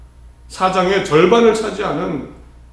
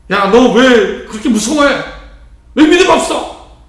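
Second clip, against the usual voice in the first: louder and much higher, a sharp mock scolding; scolding rebuke; 5 semitones higher, 6 dB louder than usual. Preacher voicing Jesus scolding fearful disciples, mock harsh. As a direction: bark it at the cowering disciples. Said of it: "라고 혼내시려는 것이 아닙니다".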